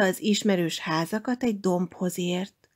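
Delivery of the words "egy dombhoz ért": ordinary delivery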